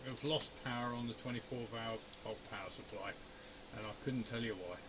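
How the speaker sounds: ordinary talk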